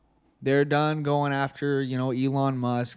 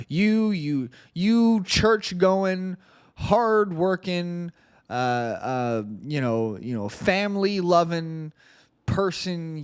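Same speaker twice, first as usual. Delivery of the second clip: drawn-out, higher mock scolding; mock accusation; 4 semitones higher, slower than usual. Host mock-accuses church-going listeners, sarcastically scolding. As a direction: stretch each word in sarcastic reproach.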